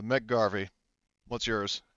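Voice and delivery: normal talking voice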